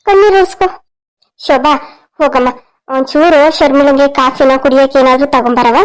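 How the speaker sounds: ordinary talk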